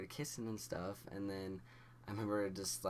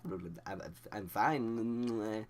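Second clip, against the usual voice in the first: stammering, nervous acted reply; hammed-up nervous stutter; twitchy fake calm; slower than usual. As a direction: stumble over the words while claiming composure. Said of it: "moving and twitching and stuff".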